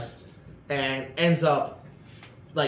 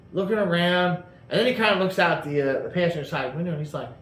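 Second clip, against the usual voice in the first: ordinary delivery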